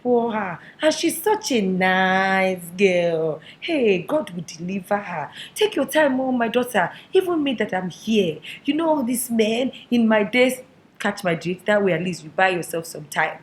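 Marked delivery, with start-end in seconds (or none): 0.0-10.6 mother's drawn-out, sympathetic lament; pitying mother. Acted. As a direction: pity the poor girl, stretching the words. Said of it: "the mother will be like".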